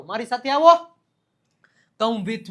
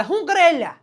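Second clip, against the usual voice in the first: loud, high-pitched hail to a pal; casual phone caller; 8 semitones higher, 8 dB louder, faster than usual. Acted. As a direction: act out a chummy phone greeting, pitched up. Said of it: "આપણે નથી કહેતા".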